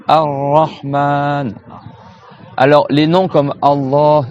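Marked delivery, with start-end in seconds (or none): 0.1-1.5 Ar-Rahman, very slow, doubled R and long A; prolonged A. Shown as a demonstration. Teacher showing students Ar-Rahman, greatly drawn out. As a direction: stretch the last A of Ar-Rahman. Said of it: "on prolonge bien le a".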